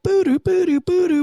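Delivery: high beeping two-note tone; radio tone imitation. Cop mimicking the radio alert tone. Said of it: "this tone goes out like".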